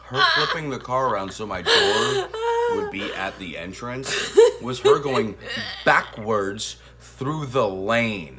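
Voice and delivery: loud, laugh-cry complaint; half laughing as you protest. Real, not acted. Husband protesting wife's backwards drive, laughing loud.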